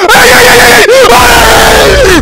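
loud high screaming ay ay ay; terrified screaming. Real, not acted. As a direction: scream in panic as it grabs you. Monster attacks player.

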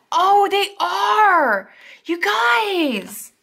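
high, slow, thrilled squeal; freaking excited. Real, not acted. Vlogger finds the washi works as headers, thrilled high squeal. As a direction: gush over it with delight. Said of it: "I got just so freaking excited just now".

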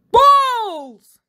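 high, loud bellow. Acted. Teacher bellowing at the sight of balls, loud high cry. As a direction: bellow it with mad delight. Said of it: "he bellowed".

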